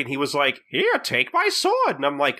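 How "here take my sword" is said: much higher, slow mock wizard voice. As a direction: play a silly high-voiced wizard, drawn out. Mock wizard handing over sword.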